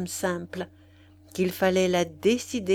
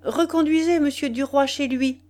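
loud, higher call to the coachman; 6 semitones higher, 4 dB louder than usual. A narrator's reading. Lady calling out to her coachman, raised voice. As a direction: call it up to the driver. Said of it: "elle cria à son cocher".

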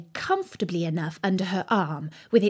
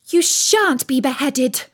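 raised, louder, emphatic promise; firm reassurance; 5 semitones higher, 5 dB louder, slower than usual. A narrator's reading. Alice protects the gardeners, loud and firm. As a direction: say it slowly and firmly, voice raised.

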